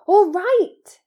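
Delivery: high, loud interjection; alarmed cry. A narrator's reading. Boy grabs the elf's arm to stop him, crying out. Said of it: "cried Harry".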